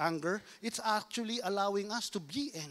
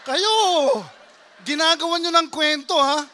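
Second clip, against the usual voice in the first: loud, high mock accusation; playful shout; 7 semitones higher, 12 dB louder than usual. Real, not acted. Pastor teasing the crowd, shouting loudly.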